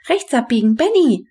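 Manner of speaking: high, rising in volume on the name; calling out directions. A narrator's reading. Henrietta directing Benny on the skateboard, calling out. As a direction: shout a quick command to a rider.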